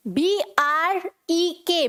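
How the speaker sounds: slow letter-by-letter "बी आर ई के"; spelling it out. Shown as a demonstration. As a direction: space out each letter in बी आर ई के. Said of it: "तो कैसे बोलेंगे इसे?".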